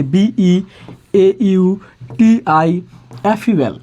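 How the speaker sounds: very slow, each of বিই এ ইউ টি আই এফ ইউ এল set apart with pauses; syllable by syllable. Shown as a demonstration. Teacher counting syllables for the class, slow and spaced. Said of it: "যখন বলা হবে অক্ষর আছে কয়টি".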